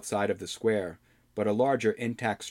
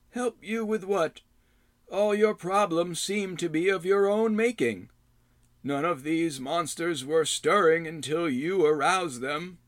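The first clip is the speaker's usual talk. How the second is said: high, slow grumpy hermit; scolding old man; 8 semitones higher than usual. Acted. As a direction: chide him like a cranky old man. Rydoth scolds.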